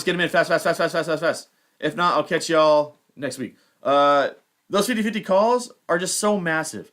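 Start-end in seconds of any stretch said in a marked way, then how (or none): 0.0-1.5 rushed repeats. Real, not acted. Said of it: "Any questions, comments".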